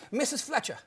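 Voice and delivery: put-on a touch of Greek-accented English; broken Greek accent. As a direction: lightly imitate a Greek grandmother's broken English. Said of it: "in her broke Greek accent".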